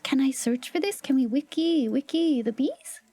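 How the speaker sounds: ordinary talk, nothing marked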